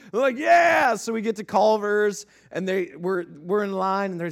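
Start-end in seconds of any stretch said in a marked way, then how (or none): 0.4-1.0 put-on high, loud kid cheer; imitated excited yell. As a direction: whoop it like thrilled boys. Boys cheering in retold story.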